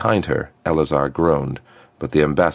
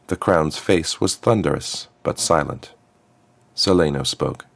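ordinary delivery

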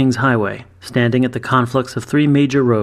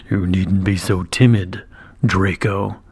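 lower, slow, growling dragon knight; basso dragon growl; 4 semitones lower, slower than usual. A narrator's reading. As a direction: rumble it from deep in the chest like a dragon. Dragon knight voiced in story.

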